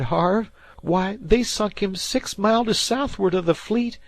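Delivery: put-on high, loud boy's voice, insistent; he swears the dead man came back; spooked fisher boy. A narrator's reading. Dan to Harvey, spooked high boy voice.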